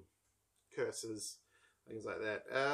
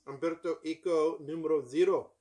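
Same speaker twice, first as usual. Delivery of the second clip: raised, loud hammed-up Italian accent; 4 semitones higher, 7 dB louder than usual. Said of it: "Sorry for that Italian accent".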